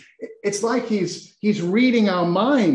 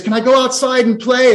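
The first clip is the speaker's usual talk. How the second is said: put-on higher, louder child's plea; 6 semitones higher, 6 dB louder than usual. Child nudging his mum during the sermon, pleading. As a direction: pipe up like a child asking permission. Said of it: "A, a child nudges his".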